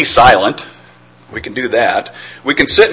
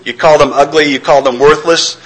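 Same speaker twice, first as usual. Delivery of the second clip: ordinary talk, nothing marked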